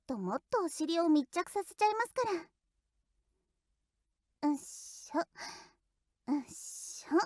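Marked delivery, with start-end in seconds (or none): 4.4-7.2 soft breathy heave-ho while shifting weight; little straining grunts, hushed; effort grunts. Acted. Shifting her weight onto him. (小さく息を漏らして踏ん張る)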